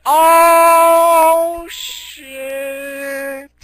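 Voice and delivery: sudden high, loud yelp of shock; shocked cry. Retold or imitated. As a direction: blurt it out in a high, loud panic. Mac sees Godzilla, shocked.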